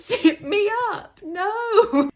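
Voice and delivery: shrieking high, slow disbelief; outraged laugh. Real, not acted. Comedian recoiling from the zip-up, in a high shrieking laugh. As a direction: howl it in horrified laughter. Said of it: "outraged at being zipped up".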